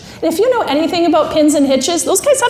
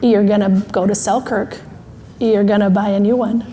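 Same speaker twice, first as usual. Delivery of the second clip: low, measured voice of reason; matter-of-fact, lowered instructions; thinking brain; 5 semitones lower than usual. Acted. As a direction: lay out the plan calmly, step by step. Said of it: "Thinking brain's like".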